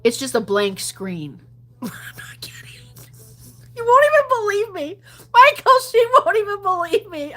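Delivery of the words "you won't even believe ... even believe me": high, loud laughing appeal; excited laughing protest. Real, not acted. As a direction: laugh it out to Michael. Laughing to Michael, disbelief.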